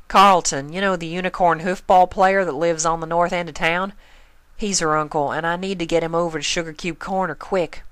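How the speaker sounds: a busy pony apologizing on the run; hurried farm pony. Acted. Narrator voices Applejack.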